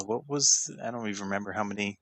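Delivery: ordinary talk, nothing marked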